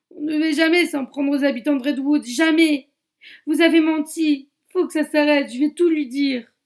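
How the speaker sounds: high, loud accusing yell; deputy raging at the killers he trusted, shouting; furious shout. Acted. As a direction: hurl the betrayal at them.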